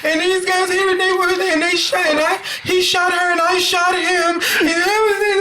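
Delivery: very high, fast, fake sobbing story; fake distraught witness. Killer fakes grief to the police, very high fake sobbing. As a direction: ham up a tearful lie to the police. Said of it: "she's putting on a whole performance".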